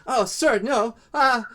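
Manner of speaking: high, loud startled shriek; panicked cry. Acted. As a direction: yelp in fright as he wakes. Kobold panics at scream.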